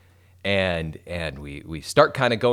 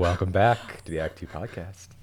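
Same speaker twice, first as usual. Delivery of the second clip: lower, softer raspy morning voice; morning voice imitation; 3 semitones lower, 5 dB quieter than usual. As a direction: copy her deep, raspy just-woke-up sound. Mimics her raspy morning voice.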